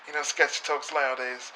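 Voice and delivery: soft-spoken Regal impression; imitating William Regal's voice. Shown as a demonstration. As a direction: drop to a near-murmur like a smooth English villain. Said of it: "I sort of talk like this".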